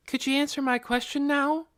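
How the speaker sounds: put-on high, light girl's voice; a curious young girl asking; young girl Nikki. A narrator's reading. Nikki's lines in the story.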